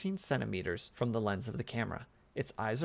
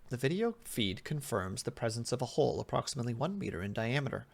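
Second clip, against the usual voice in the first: usual speaking voice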